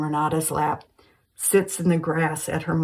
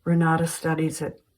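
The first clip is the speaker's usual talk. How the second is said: usual speaking voice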